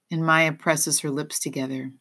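ordinary delivery

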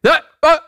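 high, loud imitated barks; two sharp mock barks; dog bark. Dog warning others off its territory, imitated barking. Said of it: "Dogs bark".

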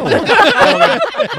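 laughing through words; high laugh breaking in. Real, not acted. Laughs while retelling.

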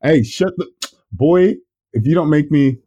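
high loud put-on scolding yell.

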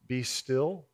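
"be still" said softly and low, a gentle invitation. Shown as a demonstration. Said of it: "you might read that as".

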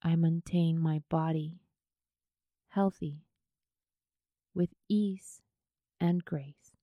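unhurried yoga nidra guidance, leaving space after each phrase; guided meditation. Acted.

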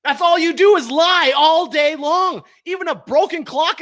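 furious slow charge at CNN; slow angry accusation. Real, not acted. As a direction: hammer every word. Attacking CNN.

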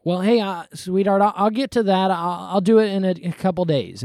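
lightly acted husband stalling; procrastinating husband. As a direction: put her off with an easy promise. Voicing a procrastinating husband to wife.

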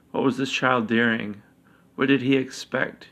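usual speaking voice

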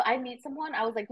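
ordinary delivery

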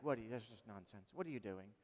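low, hushed mock bewilderment; muttered disbelief. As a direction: mutter it under your breath, baffled. Acting out reaction to others' nonsense.

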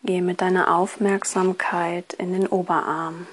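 ordinary talk, nothing marked

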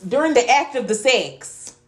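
drawn out and loud, every word weighted; slow pointed emphasis. Real, not acted. Teasing her friend for the juicy details, slow and loud. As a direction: lean hard on each word to tease it out of her.

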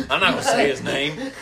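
laughing while refusing, pitch up. Real, not acted. Friend laughing as he refuses to name him.

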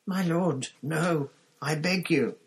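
high, drawn-out plea; frightened begging. A narrator's reading. Wormtail begs his lord before punishment, high and frightened.

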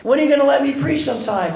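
high, playful wheedling; imitated playful pleading. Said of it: "he's kind of being playful".

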